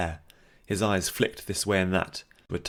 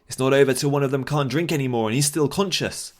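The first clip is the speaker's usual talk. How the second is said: shouting; 6 semitones higher, 5 dB louder than usual. Acted. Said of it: "shouted arguments resumed".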